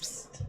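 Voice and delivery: quiet hissed psst; psst sound. Acted. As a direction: psst to get attention. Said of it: "I'm being psst".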